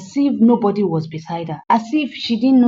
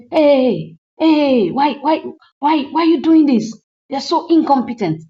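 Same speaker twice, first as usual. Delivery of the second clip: loud, higher mock scolding of an assistant; rude scolding; 6 semitones higher, 4 dB louder than usual. Star scolding her assistant over rumpled clothes, mock rude yell. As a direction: bark at the assistant in an imitated rude tirade. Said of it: "she was talking to her rudely".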